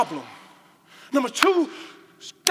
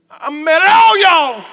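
mock mad shout; 7 semitones higher, 15 dB louder, slower than usual. Voiced speaker's anger.

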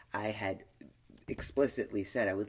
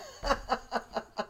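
breathy laugh; 13 semitones higher than usual. Real, not acted. Laughing after outburst.

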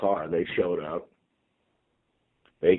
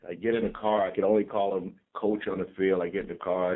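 ordinary delivery

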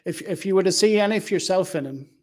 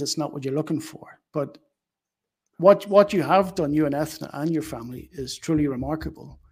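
normal talking voice

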